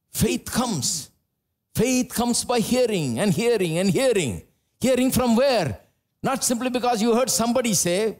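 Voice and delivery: high, rising sermon voice pressing each line home; full force preaching. Real, not acted. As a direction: preach it hard to the congregation.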